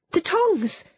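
a sudden high cry for the tongs; urgent cry. A narrator's reading. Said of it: "cried Anthea".

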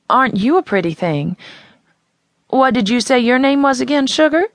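a hint of a sad woman charmed by a girl; sweet townie woman. A narrator's reading. Townie woman to the carnival girl, lightly voiced and sweet.

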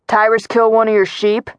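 mild taunting yell. A narrator's reading. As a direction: call it out across a field, lightly. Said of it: "he yelled".

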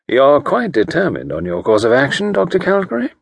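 politely probing, giving nothing away; pleasant quiet voice. A narrator's reading. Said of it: "pleasant, quiet, non-committal voice".

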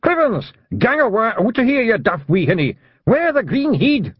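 high, shrill-free Scottish shout of a tiny man; shouting Scottish pixie. A narrator's reading. Tiny Scottish man shouting at Tiffany, high broad Scots yell. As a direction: bellow it in broad Scots like a wee angry pixie. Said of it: "waving a fist at her and shouting".

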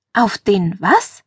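slow, stretched question rising on was; drawn-out bewildered query; baffled. Acted. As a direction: ask it slowly, totally puzzled. Baffled by what he said.

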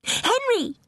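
put-on short, very high girlish call; eager princess calling to her brother; excited calling out. A narrator's reading. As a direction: call the name once, high and brief. Mary calls to brother below.

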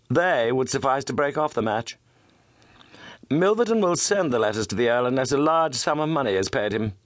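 brisk, earnest gentleman detective; Holmes voice. Acted. Holmes explains the case to Watson.

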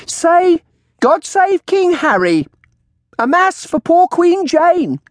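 high, loud, drawn-out prompting; shrill crone command. A narrator's reading. Old woman prompting her bird to speak, shrill. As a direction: coax a bird to repeat, shrilly. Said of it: "she called out".

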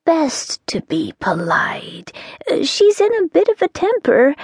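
hushed confiding whisper; a witch whispering behind her hand. Acted. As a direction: lean in and whisper the warning. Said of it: "dropped her voice to a whisper".

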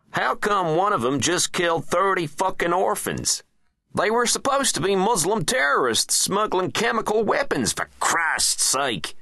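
high, forceful, exasperated presidential complaint; icy president. A narrator's reading. President grilling his men over the bombed orphans, icy and high. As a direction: berate them in a high, icy voice. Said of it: "asked the president icily".

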